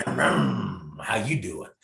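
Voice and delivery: low, very slow ladies'-man line; smooth pickup line. Pretend. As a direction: drawl it out like a suave flirt.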